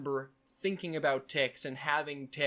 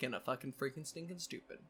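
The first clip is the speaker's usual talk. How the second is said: softer, trailing tick string; muttered tick phrase; 2 semitones lower, 10 dB quieter, faster than usual. Shown as a demonstration. Showing the tick to viewers, softer and trailing off. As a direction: let it slip out quietly. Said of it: "those are my strangest ticks".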